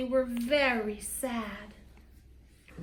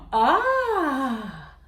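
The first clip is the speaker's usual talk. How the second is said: amazed ah. A narrator's reading. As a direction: gasp of wonder at the toys. Visitors amazed by gifts.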